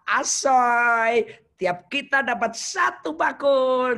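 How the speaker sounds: mock cheer. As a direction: crow over the baskets. Disciples cheering over leftover baskets.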